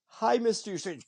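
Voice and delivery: a hint of an eager autograph seeker; timid fan greeting. Fan next in line greeting Mister Yastrzemski, lightly voiced and polite. Fan approaching idol in retold story.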